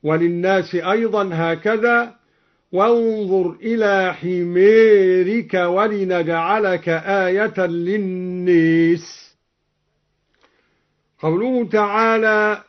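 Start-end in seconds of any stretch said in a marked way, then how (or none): 2.7-9.3 slow, long aa bent to ee in "حميرك" and "للناس"; "حميرك" as himeerik, "للناس" as linnees; imala. Shown as a demonstration. Teacher showing al-Duri's reading, imala on "حميرك" and "للناس". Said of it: "أمال كلا من حمارك وللناس أيضًا هكذا".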